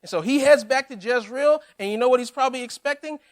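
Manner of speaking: ordinary delivery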